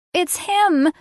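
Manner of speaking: put-on high-pitched child's cry; excited little kid. A narrator's reading. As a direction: sound like a kid spotting the monster.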